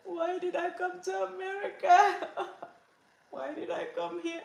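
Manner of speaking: high-pitched, half-laughing mock wail; mock crying. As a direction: wail it like a homesick newcomer, hammed up. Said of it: "I'll be crying".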